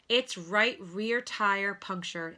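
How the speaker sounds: plain reading voice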